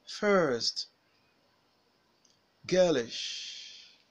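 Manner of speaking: each word alone from "Her" to "worship", er stretched and stressed, tune falling; drilling er words. Shown as a demonstration. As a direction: model "Her, certain, fern" singly with a long er and pauses. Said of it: "repeat the words with the er sound after me".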